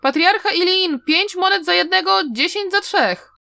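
loud, high hawker's cry; shouted sales call. A narrator's reading. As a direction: call out to the whole street. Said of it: "ktoś krzyknął". (głośne wołanie handlarza)

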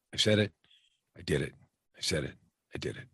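"I said it, I did it" in spaced beats, fading very soft; low, hushed "I said it, I did it", a pause after each half; cadence of success. Shown as a demonstration. Coach establishing a cadence of success, in a soft, spaced, falling rhythm. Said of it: "establish cadence of success".

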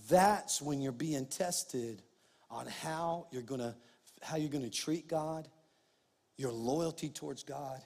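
hushed sincere sermon point; soft earnest. Real, not acted. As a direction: lean in and drop the voice.

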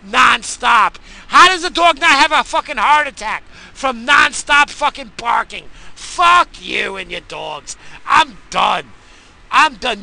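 loud, high, slow yelling; real fed-up rage; furious shouting. Real, not acted. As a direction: shout it out at the barking. Ranting rage at barking.

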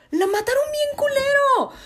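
high, wailing lament; crying wail. Real, not acted. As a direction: wail it like you're about to cry. Outraged about the deer scene.

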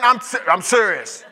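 ordinary talk, nothing marked

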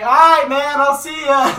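very loud, high holler; shouted farewell. Retold or imitated. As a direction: imitate yelling goodbye from a car window. Guy yells farewell from car.

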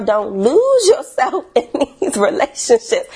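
laughing through the advice; real giggle breaking into the line; cracking up. Real, not acted. Advising viewers while cracking up laughing. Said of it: "I'm cracking up every time I say that".

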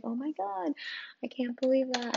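ordinary talk

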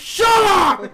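very high, loud yell; exasperated shout. Real, not acted. Silencing heckling friends, high exasperated shout. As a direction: shout them all down.

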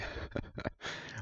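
a soft, quiet laugh at himself; short quiet laugh. Real, not acted. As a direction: chuckle quietly at your own joke.

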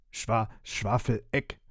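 slow, stumbling correction of the name; hesitant correction. Acted. Vampire slowly corrects the worker on his name. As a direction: correct him timidly, dragging the name out. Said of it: "berichtigte Bodomil den Fremden".

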